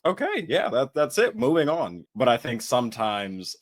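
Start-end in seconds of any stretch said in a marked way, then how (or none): 0.0-2.1 high, quick brush-off of the whole talk; a lightly acted wrap-up, high and a little louder; mock brisk dismissal. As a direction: toss it aside in a much higher voice, done with it. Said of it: "they're like".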